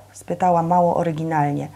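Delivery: usual speaking voice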